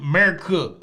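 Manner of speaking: put-on AMERICA squashed to "Murcuh" in Southern accent. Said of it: "You're gonna say America?".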